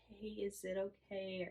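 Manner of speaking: meek, hesitant question; timid asking. Retold or imitated. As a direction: ask as if afraid of the answer. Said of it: "it wasn't like".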